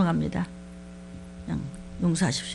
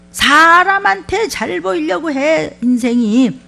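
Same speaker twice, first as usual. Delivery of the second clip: emphatic preaching; 7 semitones higher, 8 dB louder, much slower than usual. Acted. Preacher stressing a scripture point.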